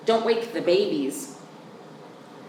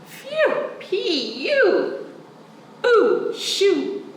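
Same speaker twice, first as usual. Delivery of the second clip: disgusted shooing; 12 semitones higher, much slower than usual. Acted. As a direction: yelp at the smell. Child reacts to skunk spray.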